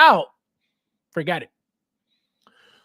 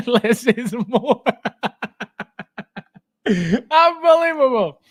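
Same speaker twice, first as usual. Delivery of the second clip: laughing; 4 semitones higher, 5 dB louder than usual. Real, not acted. Collector laughing at other channels.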